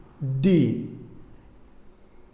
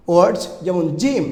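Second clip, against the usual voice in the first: usual speaking voice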